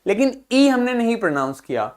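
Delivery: ordinary talk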